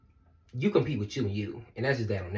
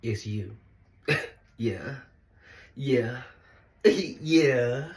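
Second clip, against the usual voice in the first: ordinary delivery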